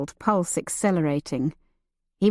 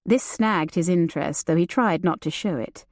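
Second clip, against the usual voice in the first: usual speaking voice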